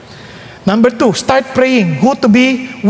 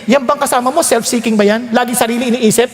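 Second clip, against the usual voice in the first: ordinary talk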